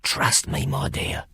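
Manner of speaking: soft unvoiced whisper. A narrator's reading. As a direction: breathe it only for her. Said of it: "so that only she could hear".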